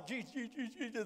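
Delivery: very soft, rapid little squeaks; mimicked fleeing prayer. Preacher imitates little women fleeing down the aisle, hushed hurried chanting. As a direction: imitate timid ladies praying as they scurry off. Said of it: "there goes the three little women down the side aisle".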